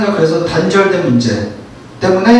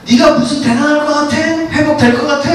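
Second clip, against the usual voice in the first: higher, sneering voice full of doubt; mocking Satan; 8 semitones higher than usual. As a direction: taunt them that they'll never recover. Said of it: "이렇게 하는 이 사탄". (사탄이 비웃듯 높은 목소리로 조롱하며)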